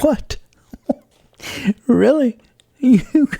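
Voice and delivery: high, laughing disbelief; startled laugh. Retold or imitated. Told the landlord's brother offered the building, laughing in high surprise. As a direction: squeal out the surprise through a laugh.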